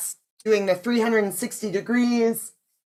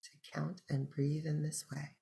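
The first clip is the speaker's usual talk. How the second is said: soft meditation voice with long pauses; unhurried, hushed breathing-practice guidance; guided meditation; 5 semitones lower, 5 dB quieter than usual. Acted. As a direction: guide listeners gently into the breath, leaving space between phrases.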